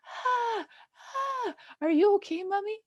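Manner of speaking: high, panicky child voice, mock; worried little girl. Mom imitates her daughter calling through the bathroom door, high and panicky. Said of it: "You're going".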